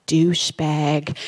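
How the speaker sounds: a low, slow, teasing big-sister voice; drawn out low like sharing a naughty secret; big sister's secret word. Retold or imitated. Big sister teaching little Isabel a word, low and slow. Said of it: "My sister kneels down beside me and says".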